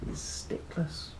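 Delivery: usual speaking voice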